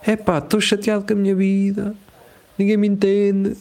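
high-pitched, put-on whiny voice, poking fun; mock whiny complainer. Person venting into a microphone on the street, high mock whine. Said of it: "dizes assim".

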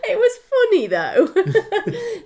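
loud, high, through laughter; helpless amusement. Real, not acted. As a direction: squeal it out while still laughing. Still laughing at the memory.

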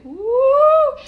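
high, loud whoop; loud woo. Retold or imitated. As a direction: let out a big woo. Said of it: "felt the blood rush to my head".

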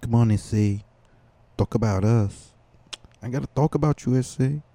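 joking Mexican-accent impression; mock Mexican accent. Comic playing two people calling to S C, mock Mexican accent. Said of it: "me doing a, a Mexican accent".

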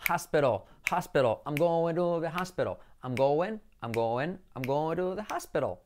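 "hospital" as HAS-pero; pronunciación correcta. Shown as a demonstration. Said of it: "Como áspero". ("hospital" como «jáspero»)

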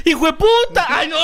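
laughing shout. Real, not acted. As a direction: shout it through real laughter. Bursts out laughing at the joke.

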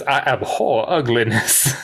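lightly imitated Southern drawl; mock-genteel Southern delivery; Doc Holliday. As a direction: savor "abhor" in a slight Southern drawl. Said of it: "the way he says".

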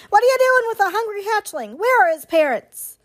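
high, quick indignant goose; squawking goose. A narrator's reading. Old goose challenging the robot about the hatchling, squawking. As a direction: squawk an accusing demand. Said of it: "squawking to the robot".

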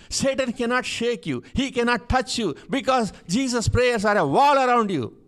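high, loud, emphatic sermon delivery; impassioned preacher's cry, building to a loud finish; full-force preaching. Real, not acted. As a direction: proclaim it like a preacher at the peak of the sermon.

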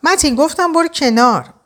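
high-pitched, restrained anger; tense attempt at staying calm; anger held in. A narrator's reading. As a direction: struggle to keep your temper while giving an order. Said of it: "از عصبانیت بست و در حالی که سعی داشت آرامشش رو حفظ کنه".

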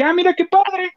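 high mock delight. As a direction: squeal it out, pleasantly surprised.

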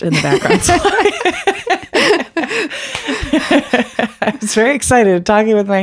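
giggling through the apology; laughing. Real, not acted. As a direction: laugh it off, embarrassed.

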